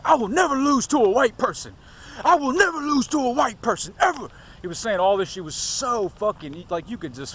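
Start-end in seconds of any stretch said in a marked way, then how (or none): 0.0-4.3 high, loud mock rant of a furious boxer; angry boxer. Vlogger imitating the boxer's furious interview rant. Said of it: "he was like".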